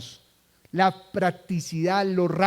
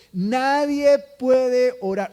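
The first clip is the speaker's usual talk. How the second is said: slow, louder, weighty preaching line; slow emphatic statement; 4 semitones higher, 6 dB louder, much slower than usual. Real, not acted. Preacher stressing a premise to the congregation, slow and weighty. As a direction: drag out each word for weight. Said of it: "Escuche esto".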